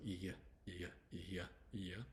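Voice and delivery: "iya" as a drawn glide from ee to uh; Y sound. Shown as a demonstration. Teacher drilling the Y in yours, isolated glide. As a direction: sound out "iya" slowly, one at a time. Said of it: "Y can be y, y, y".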